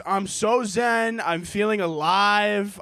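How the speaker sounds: ordinary talk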